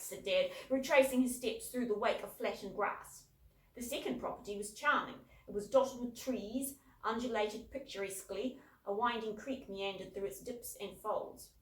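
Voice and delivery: plain reading voice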